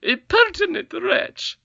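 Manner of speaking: high, slow, loud scornful squeal; utmost scorn. A narrator's reading. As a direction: spit it out in disdain. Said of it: "utmost scorn in her voice".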